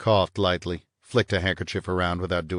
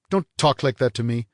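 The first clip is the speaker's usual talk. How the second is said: snapping; 5 semitones higher, 4 dB louder than usual. A narrator's reading. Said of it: "Vanier snapped".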